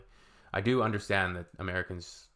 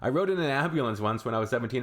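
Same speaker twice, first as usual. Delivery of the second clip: ordinary talk, nothing marked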